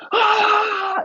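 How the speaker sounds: long, very high-pitched victory scream; celebratory scream. Retold or imitated. Teammates grabbing helmet straps after winning, celebratory scream. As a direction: scream in triumph, imitating the finish-line moment. Retold celebration in each other's faces.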